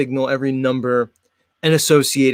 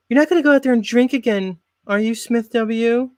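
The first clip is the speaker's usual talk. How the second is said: much higher, fast suspicious wife; the wife catching him heading to drink; nagging wife; 9 semitones higher than usual. Acted. Wife voiced in skit.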